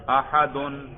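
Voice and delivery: احدن said as "ahadun" with a clear u ending; nominative ending. Shown as a demonstration. Teacher shows students the nominative ending, احدن as "ahadun". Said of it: "دیکھو میں رفع سننے سے ہی آپ کو پتا چل گیا".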